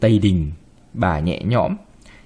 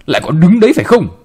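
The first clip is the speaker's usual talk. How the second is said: fast, higher, louder angry snap; grandma yelling at the frozen girl; snapping; 5 semitones higher, 5 dB louder, faster than usual. Acted. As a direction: bark it at her to move. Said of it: "bà quát".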